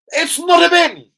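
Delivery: high, loud indignant local man; mock country man. Telling the story of a villager scolding him over litter, high indignant voice.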